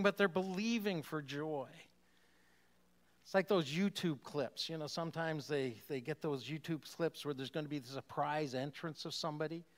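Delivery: ordinary talk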